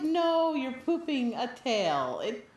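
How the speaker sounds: high, loud, drawn-out protest; indignant high-pitched objection; mock exasperation. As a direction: protest loudly, stretching the words. Mock outburst at absurd idea.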